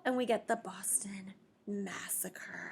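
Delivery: hushed, breathy drop after the shout; sudden whispered aside; dramatic whisper. Shown as a demonstration. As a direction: whisper it like a grim secret. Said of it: "dramatic whisper after yell".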